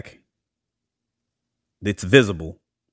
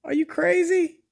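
much higher, squealing mock-lecture; exasperated scolding; 14 semitones higher, 4 dB louder than usual. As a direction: shriek the advice like an outraged auntie. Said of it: "I said".

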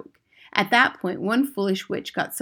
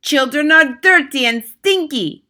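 high, loud scream; 9 semitones higher, 11 dB louder than usual. Acted. Grand High Witch screaming insults about children, loud. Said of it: "screamed the Grand High Witch".

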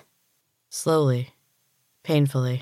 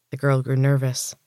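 usual speaking voice

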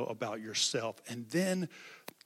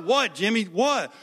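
higher, louder worried call; urgent calling; 7 semitones higher, 9 dB louder than usual. Retold or imitated. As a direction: call out to the boy in alarm. Friends calling to crying boy.

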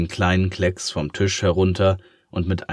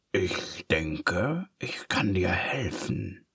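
slow, oily whispered offer; sly villain whisper; dark whisper; 7 dB quieter, slower than usual. Acted. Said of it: "dunkle, triefende Stimme flüstern".

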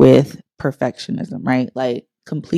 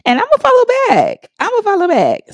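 much higher, loud, eager squeal; excited; 5 dB louder than usual. Real, not acted. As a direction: gush it out, delighted. Eager promise to follow back.

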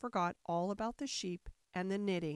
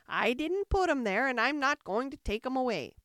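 louder, raised refusal; indignant character voice; grumpy Sheep; 3 semitones higher, 6 dB louder than usual. A narrator's reading. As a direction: refuse crossly as the testy Sheep.

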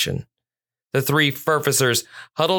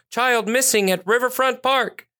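high-pitched dispatcher call; radio dispatcher; 10 semitones higher than usual. A narrator's reading. Dispatcher alerting officers to a missing child, high urgent call. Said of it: "Dispatcher Ashley's voice echoed over the radio".

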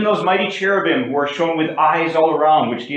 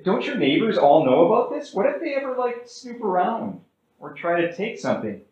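lightly voiced higher-pitched questioner; questioning church member; 6 semitones higher than usual. Church member asks the survivalist about neighbors, questioning. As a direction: ask as a curious member, pitched up. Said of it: "one of my members asked him".

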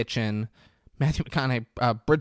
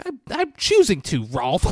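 mock high woman's retort; 7 semitones higher, 4 dB louder than usual. Carol answering Rolf.

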